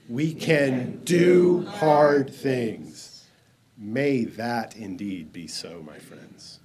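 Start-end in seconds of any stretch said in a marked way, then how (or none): 0.1-3.2 DO, HARD and THINGS each slow and emphatic; stress on DO HARD THINGS. Shown as a demonstration. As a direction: land heavily on "do hard things", word by word. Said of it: "emphasis on the doing hard things".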